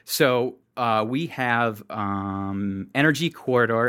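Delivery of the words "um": "um" stretched out low and soft; long "um". Real, not acted. Host hesitating before event name, drawn-out "um". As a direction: drag out the "um". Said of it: "I just said, um, real long".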